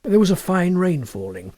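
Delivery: ordinary delivery, nothing marked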